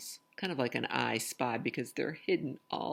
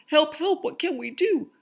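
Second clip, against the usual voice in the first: much higher, louder frantic call; panicked cry for help; 13 dB louder than usual. Acted. Townspeople panicking as a shed burns, frantic high cry. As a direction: cry out like townsfolk watching a fire.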